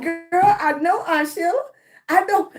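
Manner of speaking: put-on high, loud, confident girl voice; aunt imitating her niece's cheeky confidence, high and loud. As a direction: play the proud little niece, high and loud. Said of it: "She was like".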